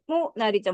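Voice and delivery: "모나리자"의 "모"에 강세; "모나리자"에서 "모"를 힘주어; 첫음절 강세. Shown as a demonstration. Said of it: "모나리자 강세". ("모나리자"의 "모"를 세게)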